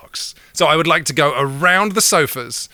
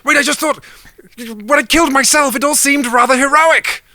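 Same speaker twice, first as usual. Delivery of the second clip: high, loud voice for Death; 12 semitones higher, 5 dB louder than usual. Acted. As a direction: pitch it way up as Death. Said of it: "Death says".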